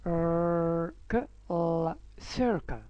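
very slow ر ك ل then سيركل blended; blending letter sounds. Shown as a demonstration. Teacher blending circle for kids, slow. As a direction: sound out ر ك ل one by one, then join. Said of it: "هنطلع صوتها".

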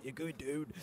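dodgy mock-Scottish accent; bad Scottish accent. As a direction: repeat the jibe quietly in faked Scottish. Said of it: "I can't do a Scottish accent".

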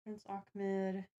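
ordinary talk

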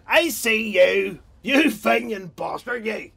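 slow, high, loud jeering yell across the street; mock sectarian shout. Man across the street yelling a slow taunt at his rival. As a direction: bellow it like a loyalist taunting his neighbour.

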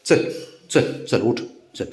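normal talking voice

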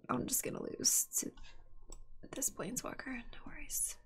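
soft whispered resignation; whispered, defeated acceptance; resigned whisper. Real, not acted. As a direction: give up in a hush. Resigned to losing.

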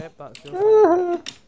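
high, loud, slow accusing shout; slow shouted blame. Real, not acted. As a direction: drag out the blame at full volume. Blaming friend after death.

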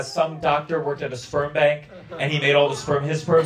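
ordinary talk, nothing marked

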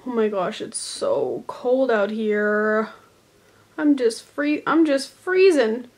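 high, loud freezing complaint; shivering. Pretend. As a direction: act frozen and shrill. Acted cold skit character.

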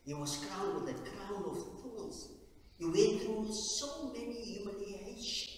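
ordinary talk

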